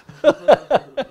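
high, loud burst of laughter; laughing. Real, not acted. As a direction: crack up at the punchline. Laughs at his own punchline.

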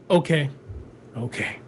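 resigned calm, dropping to very soft and low; moment of calm. Acted. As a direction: quietly accept it, fading on the second word. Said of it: "that moment of calm".

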